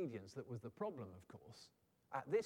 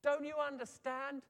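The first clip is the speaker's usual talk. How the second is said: high, raised, insistent plea; God, exasperated; 8 semitones higher, 4 dB louder than usual. Acted. God looking at me, exasperated and insistent. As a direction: press it home like an exasperated shout. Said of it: "God's probably looking at me and saying exactly that".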